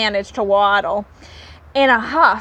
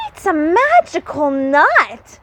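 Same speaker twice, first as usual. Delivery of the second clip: higher, louder wonder; shout; 5 semitones higher, 4 dB louder than usual. Acted. Littlest rabbit to the animals, amazed shout. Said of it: "shouted the littlest rabbit".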